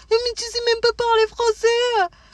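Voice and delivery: high, slow, scornful put-down; mocking French person. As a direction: sneer at them like a smug local. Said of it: "Alors qu'en France".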